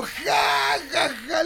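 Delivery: mock laugh.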